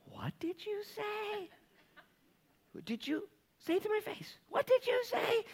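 high, slow, disbelieving; incredulous. Retold or imitated. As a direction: squeak out shocked disbelief. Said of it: "And he's like".

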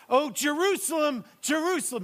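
high, loud lament, calling out to the city; voicing Jesus pleading. Jesus pleading with Jerusalem, loud lament. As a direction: cry out to Jerusalem like a grieving voice. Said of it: "Here he says".

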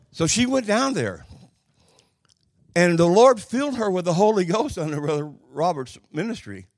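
high, loud preaching climb. Acted. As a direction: lift it like a revival preacher. Preaching climax.

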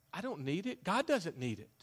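usual speaking voice

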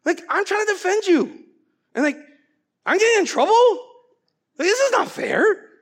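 high, loud whining complaint of unfairness; indignant protest. Retold or imitated. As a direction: protest like a wronged teenager. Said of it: "I remember going, like".

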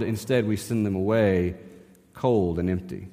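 normal talking voice

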